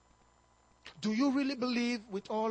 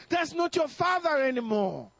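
normal talking voice

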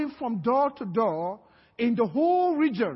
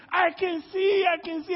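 high, elated acted outcry; joyful exclamation; 7 semitones higher than usual. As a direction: cry out as if suddenly healed. Healed blind man cries out.

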